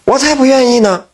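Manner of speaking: put-on high, huffy young lady refusing; indignant refusal. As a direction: snap back like a spoiled miss. 大女儿拒绝嫁蛇. (大小姐高声不屑地拒绝)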